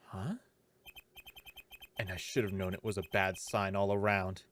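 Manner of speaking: hushed narrator reading; quiet inner narration. Acted. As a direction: read the thoughts softly to yourself.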